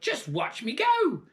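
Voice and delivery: slow, high, loud hag boast; slow gloating crone. Acted. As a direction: savour each word. Grandma gloating.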